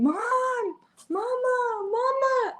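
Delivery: high, sing-song calling of mama; child calling mom. Retold or imitated. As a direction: call out like a small boy looking for his mom. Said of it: "and he's yelling".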